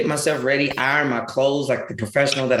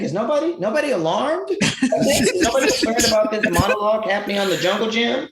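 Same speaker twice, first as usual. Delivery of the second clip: mock outrage; 7 semitones higher, faster than usual. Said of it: "I'm like".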